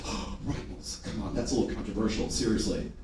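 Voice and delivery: low, soft mock protest; mock dismissive seller. Salesman self-sabotaging his sales, low and dismissive. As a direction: mutter it low, brushing it off. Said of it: "You say".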